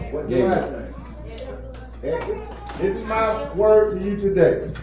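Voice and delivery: ordinary talk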